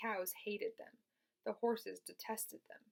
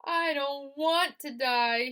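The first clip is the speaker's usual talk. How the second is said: high, loud, slow moan; 7 semitones higher, 12 dB louder, slower than usual. A narrator's reading. Wilbur bursting into tears, slow loud moan. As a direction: moan it out tearfully. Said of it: "he moaned".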